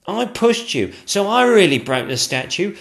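high, loud, earnest confession; eager footman. Acted. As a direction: rush to take blame. Footman claiming money.

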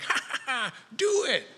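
put-on high, teasing kids' laugh then a jump up on do it; lightly playing his kids egging him on; mock kid taunt. Kids daring their dad to stand in rain, teasing high laugh.

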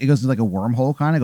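normal talking voice

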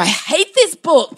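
high, slow hammed-up anger; mock tantrum. Mother grabbing her son's homework book, mock tantrum. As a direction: stretch the hatred out theatrically. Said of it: "slammed a book and gone".

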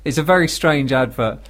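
normal talking voice

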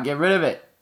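normal talking voice

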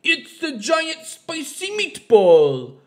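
loud, high, drawn-out mock Italian accent. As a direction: belt out a hammy Italian accent, slowly. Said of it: "he's practicing his Italian accent".

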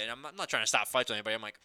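ordinary talk

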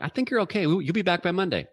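a breezy, high mock voice for the trainer; lightly mocking high school trainer. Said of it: "she's like".

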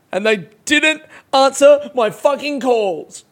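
exasperated. Real, not acted. Venting about missed call.

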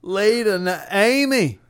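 high calling out her name. Acted. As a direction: call her back. Calling out in skit.

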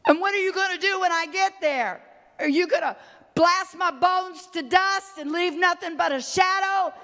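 high-pitched, urgent preacher's questioning; impassioned preaching. Acted. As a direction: cry the question out from the pulpit. Preacher acts out a fearful question.

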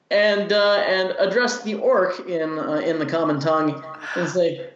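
ordinary talk

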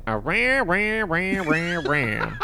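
exaggerated American drone on a repeated syllable; mock American accent. Relaying a French friend's take on Americans, mock American honking. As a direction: honk out a caricature of American speech. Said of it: "That's what American people sound like to them".

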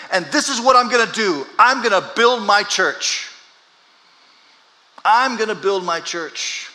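each phrase rising to a cry; preaching at full force. Acted. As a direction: drive the point home.

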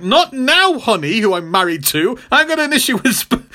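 high, irritated brush-off; mock snapping at a husband, pitched high; Jessica. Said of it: "And she's like".

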